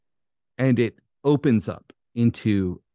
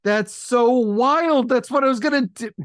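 amazed disbelief; 12 semitones higher than usual. Real, not acted. GM amazed that the player had his idea, high. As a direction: sound stunned that you both had the same idea.

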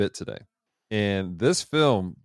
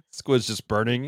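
ordinary delivery

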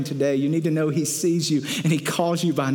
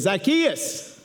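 mildly projected call, pitched higher; 4 semitones higher than usual. Acted. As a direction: call someone out by name. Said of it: "calls him out".

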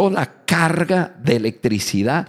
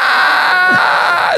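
high, loud shrieking ah; mock the wife's startled shriek; high-pitched imitated scream; 4 dB louder than usual. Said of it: "Se pone a gritar".

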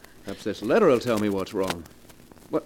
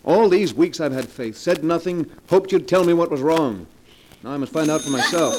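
raised, louder rising grievance; hurt anger; 6 semitones higher, 5 dB louder than usual. Acted. Husband venting weeks of doubt at wife, raised angry voice. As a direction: let weeks of suspicion spill out.

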